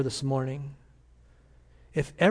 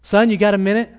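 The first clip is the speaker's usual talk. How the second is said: mother knocking again, louder and higher; a mother's second try at the door, raised and higher; 6 semitones higher, 8 dB louder than usual. Retold or imitated. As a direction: call through the door with more push this time. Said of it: "knocked on the door".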